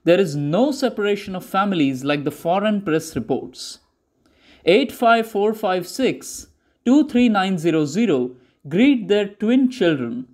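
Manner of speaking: plain reading voice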